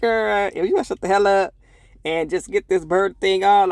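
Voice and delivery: loud, high, fed-up telling-off; annoyed snap. Retold or imitated. Reliving telling the chatty nurse to shut up, loud and irritated. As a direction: tell her off like she's wasting your time. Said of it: "she was getting on my nerves".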